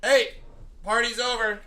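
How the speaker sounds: retold call-out.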